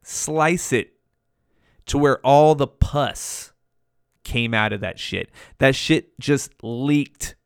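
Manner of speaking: ordinary talk, nothing marked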